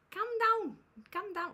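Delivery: hammed-up Scouse, pitched high on the first; mock Scouse accent. Streamer joking that the mic gave him a Scouse accent, mock Scouse. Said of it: "I've now got a Scouse accent".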